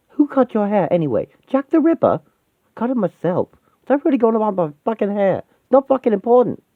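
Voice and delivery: high-pitched irritated back-and-forth; agitated bickering. Acted. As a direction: snap it out, pitch jumping up. Acted quarrel at a tense reunion.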